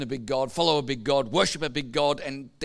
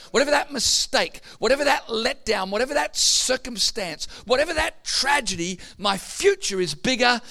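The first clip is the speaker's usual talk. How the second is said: raised, high-pitched sermon delivery; preaching at full force; 7 semitones higher, 3 dB louder than usual. Real, not acted. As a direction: drive it home to the congregation.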